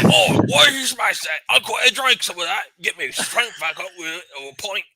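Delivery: put-on heavy British accent. NPC asks the party for a drink in heavy British accent. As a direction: slur it like a thirsty British local. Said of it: "heavy British accent".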